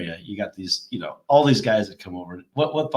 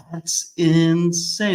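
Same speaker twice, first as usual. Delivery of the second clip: slow, higher mock amazement; amazed, drawn out; 7 semitones higher, much slower than usual. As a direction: stretch it out in disbelief. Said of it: "And I was like".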